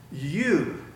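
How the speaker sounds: preacher singling out each listener; emphatic You. Shown as a demonstration. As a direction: point at them with "You,". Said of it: "especially you".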